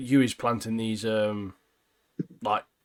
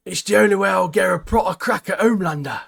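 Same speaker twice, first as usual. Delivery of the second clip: mock gruff Butcher line, a bit louder; imitating Butcher; 7 semitones higher, 4 dB louder than usual. Friend quoting Butcher, lightly put-on voice. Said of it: "Why does he talk like that".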